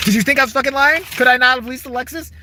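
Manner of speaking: prove your point, voice shooting up; indignant outburst. Real, not acted. Vindicated rant over repair bills.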